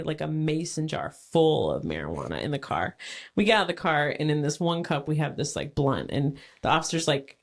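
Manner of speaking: ordinary delivery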